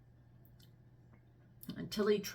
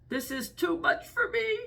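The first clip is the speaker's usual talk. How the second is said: very high, loud pinched nasal cry; through the nose; 12 semitones higher, 5 dB louder than usual. Acted. Elephant's child being pulled by the nose, high loud nasal cry. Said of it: "he said through his nose".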